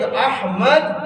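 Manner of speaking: احمد as "AH-mad", h fully sounded; correct pronunciation. Shown as a demonstration. Teacher showing class the name, correct pronunciation. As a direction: open first "a" and clear h in احمد. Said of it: "احمد ہے صحیح تلفظ".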